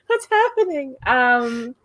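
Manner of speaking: high-pitched baffled cry; comic high-voiced bewilderment; bewildered bystander. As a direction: squeak out confusion from the sidelines. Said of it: "I like how [name]'s in the background".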